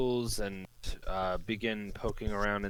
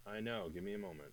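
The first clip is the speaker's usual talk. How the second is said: very faint, far-off voice; barely audible; 11 dB quieter, much faster than usual. Real, not acted. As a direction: voice barely reaching the mic. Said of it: "You sound very faint".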